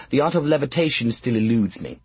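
ordinary delivery, nothing marked

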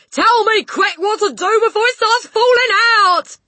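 very high, loud pleading; panicked wail. A narrator's reading. Father fearing hair loss, panicked wail. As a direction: wail for urgent help. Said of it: "wailed the father".

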